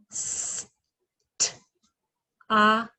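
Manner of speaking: s, t, a said apart; touch and say each sound; each phoneme said separately; segmenting stop. Shown as a demonstration. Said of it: "So let's give the example of stop".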